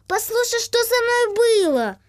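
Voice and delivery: put-on excited little boy.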